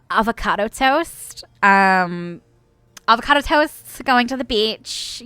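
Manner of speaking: put-on slow, drawn-out Valley Girl; Valley Girl accent. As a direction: stretch the vowels like a Valley Girl. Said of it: "a Valley Girl kind of American".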